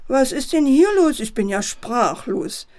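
teacher bursting in on the toy party, outraged; indignant. A narrator's reading. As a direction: scold the messy room. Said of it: "entrüstet sich die Leiterin".

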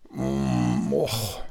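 low, drowsy "och" groan; verschlafenes Stöhnen. A narrator's reading. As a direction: groan like flopping back into bed. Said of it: "machte er und ließ sich zurück ins Bett fallen". (müdes, tiefes Stöhnen)

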